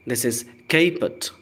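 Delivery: normal talking voice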